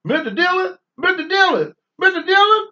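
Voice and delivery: high, loud whiny sidekick call; Chester on Gunsmoke. Retold or imitated. Imitating Chester calling for Mister Dillon, whiny and high. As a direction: call out like Chester hollering for the marshal.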